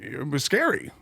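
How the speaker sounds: ordinary talk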